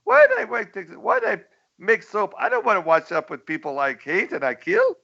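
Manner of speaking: high, indignant mock dictator; cartoon Pol Pot voice. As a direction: squeal it like a cartoon tyrant. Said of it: "He looks at him like".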